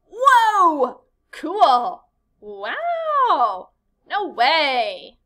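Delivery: loud, high excited exclamations. Shown as a demonstration. Teacher showing kids excitement, loud and high. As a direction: sell the thrill to the class. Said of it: "a sentence that can show excitement".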